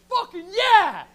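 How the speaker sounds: loud, high whoop; triumphant cheer. Retold or imitated. Comic imagining cheering a great comeback, loud whoop. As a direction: cheer like you won something. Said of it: "so I could have been like".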